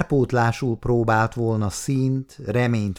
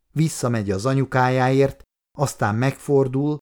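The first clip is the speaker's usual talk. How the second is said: ordinary delivery, nothing marked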